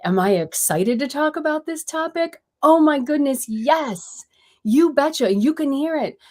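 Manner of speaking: an eager host showing how much she loves the topic; excited. Shown as a demonstration. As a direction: let the excitement ring out in every word. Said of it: "you can hear the inflection in my voice".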